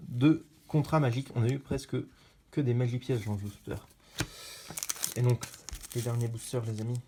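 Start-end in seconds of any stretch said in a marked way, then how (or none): none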